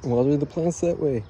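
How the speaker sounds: talking through hiccups; words jolted by hiccups. Real, not acted. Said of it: "I have hiccups".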